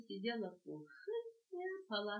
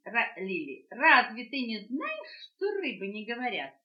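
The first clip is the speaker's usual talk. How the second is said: frog mocking; 3 semitones higher, 10 dB louder than usual. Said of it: "лягушка".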